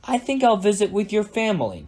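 high, taunting villain farewell; mildly wicked storybook taunt, no real cackle; cackling villain. A narrator's reading. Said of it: "cackled Susan".

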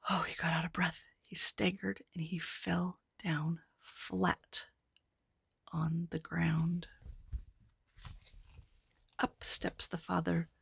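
soft unvoiced whisper; hushed. A narrator's reading. Storyteller whispering as the giant collapses. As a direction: tell it softly as the giant falls.

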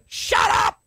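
high, full-throated yell at the devil; rebuking shout. Shown as a demonstration. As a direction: bark it down with total authority. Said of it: "That's the way you're gonna have to talk".

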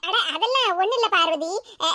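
normal talking voice

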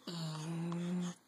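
soft whispered word. Acted. Parent whispering bedtime goodbye to the air. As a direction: let the word fade into a whisper.

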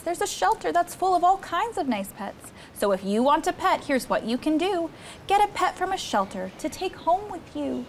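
lively picture-book read-aloud; reading to children. Acted. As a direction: read it like a storybook for a small child.

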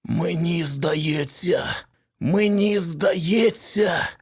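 slow, rasping whisper of a voice; very hoarse. A narrator's reading. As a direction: croak it out slowly through a new throat. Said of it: "вимовив дуже сипло".

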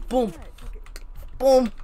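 ordinary talk

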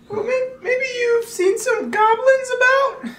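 put-on high, slow, creaky old man. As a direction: ask it like a frail old monk. Player voices old monk character.

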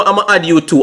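ordinary delivery

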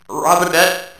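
loud mocking disbelief; incredulous. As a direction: scoff at the name. Mocking the odd middle name.

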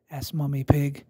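plain reading voice

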